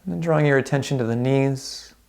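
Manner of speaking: calm, unhurried meditation guide with long pauses; guided meditation. Real, not acted. Instructor guiding listeners through a body scan, calm guided meditation. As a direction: ease them gently through a body scan, leaving space between phrases.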